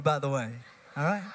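normal talking voice

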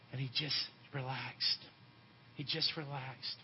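low, soft near-murmur; hushed. Real, not acted. Preacher recalling his boy relaxing, hushed. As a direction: let it settle, soft and low. Hushed after whispered comfort to son.

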